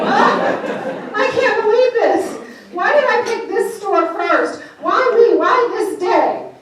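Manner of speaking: high, loud mock lament; hammed-up woe-is-me, stretched out; self-pitying wail. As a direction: wail like you're throwing yourself a pity party. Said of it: "I feel sorry for myself".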